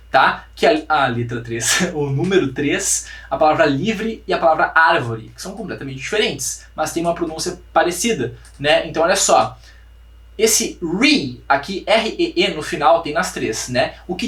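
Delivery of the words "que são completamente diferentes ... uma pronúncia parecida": usual speaking voice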